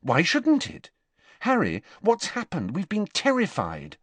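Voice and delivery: high, pressing, worried questions; anxious Hermione. A narrator's reading. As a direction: fret at him for answers. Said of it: "said Hermione anxiously".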